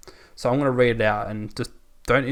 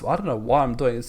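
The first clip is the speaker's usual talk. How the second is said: usual speaking voice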